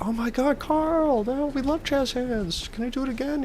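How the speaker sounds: gushing fans. Retold or imitated. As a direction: squeal it like an adoring fan in falsetto. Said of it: "And everyone's like".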